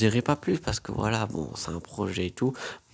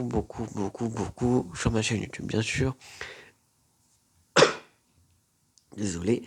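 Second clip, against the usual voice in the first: choking mid-sentence; words catch in the throat. Real, not acted. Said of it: "je m'étouffe".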